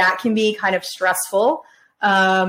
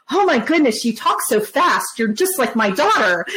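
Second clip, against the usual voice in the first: amazed exclamation, pitched up at the start; Terry Fletcher; 3 semitones higher than usual. Retold or imitated. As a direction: imitate an astonished friend gushing. Imitates caller amazed at fast talk.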